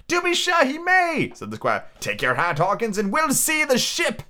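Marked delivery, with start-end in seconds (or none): 0.1-1.3 very high, emphatic character voice; hearty squire. Acted. Squire granting the doctor's request, with hearty assent. 2.0-4.2 squire. Acted. Voices the squire.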